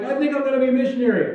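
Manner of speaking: high, quick burst of imitated zeal; eager convert. New believer sold out for Jesus, imitated as eager. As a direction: gush it out like a keen newcomer. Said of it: "Somebody gets sold out for Jesus".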